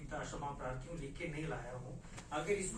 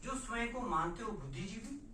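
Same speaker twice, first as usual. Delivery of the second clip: loud, raised, challenging declamation; forceful poem recital; 8 semitones higher, 8 dB louder than usual. Acted. Poet confronting silent intellectuals in verse, loud high declamation. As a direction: hurl each question at the listeners.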